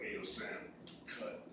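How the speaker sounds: soft, breathy plea; hushed cautious ask; timid whisper. Retold or imitated. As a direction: whisper it nervously so he won't blow up.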